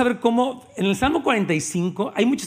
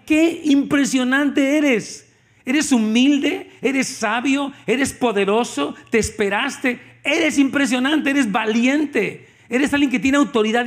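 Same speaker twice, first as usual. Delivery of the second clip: slow, higher, louder awe-filled praise; admiring praise; 5 semitones higher, 3 dB louder, slower than usual. Acted. The Father praising Christ, slow and awe-filled. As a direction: linger on each word in wonder.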